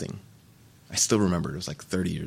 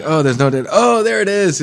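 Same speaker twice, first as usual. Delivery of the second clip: much higher and louder sudden find; surprised discovery; 10 semitones higher, 8 dB louder than usual. Retold or imitated. Preacher reliving finding Jeremiah, high and loud. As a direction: burst out as the page turns up. Finding the verse, retold excitement.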